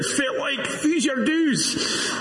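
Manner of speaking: put-on high-pitched Brazilian-accented call, drawn out at the end; shouted Brazilian accent. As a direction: imitate a Brazilian calling out Doric in a high, slow voice. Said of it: "this guy in a Brazilian accent shouted out".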